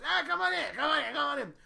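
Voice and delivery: high, loud welcoming Bob; an over-eager host calling friends inside; Bob impression. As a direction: wave them in, loud and high.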